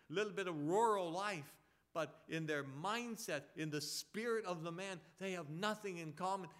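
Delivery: driving preacher, key words hit hard; forceful preaching. Real, not acted. Preacher urging the church to live for Christ, forceful preaching. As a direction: preach it with rising conviction.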